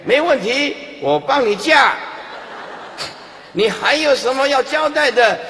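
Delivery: loud, high, emphatic mock assurance; demonstrated hearty promise. Shown as a demonstration. Helper reassuring the dying parent at full volume, comically eager. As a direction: shout instant agreement, then press on. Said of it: "对死人要这样讲的".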